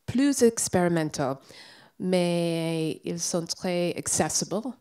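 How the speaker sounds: English-speaker's French, careful and halting; French with a strong American accent; foreign accent in French. Real, not acted.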